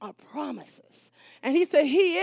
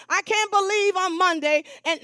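ordinary talk

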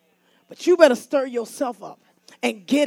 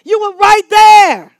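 slow shouted climax, much louder and higher; preaching shout; 6 semitones higher, 12 dB louder, slower than usual. Acted. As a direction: hammer each word out to the church.

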